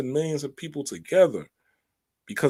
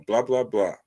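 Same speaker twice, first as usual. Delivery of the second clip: ordinary delivery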